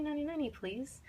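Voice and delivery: put-on high, soft kid chorus echo; teacher mimicking the class echo, high and quiet; imitating the kids. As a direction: parrot it back like children. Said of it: "the kids would say".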